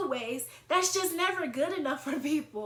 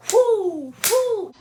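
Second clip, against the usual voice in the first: high, loud falling "whoo"; explosive whooped sound effect; loud fight whoops; 8 semitones higher, 12 dB louder than usual. Retold or imitated. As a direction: hoot out each swing of the fight. Imitates herself attacking in fight.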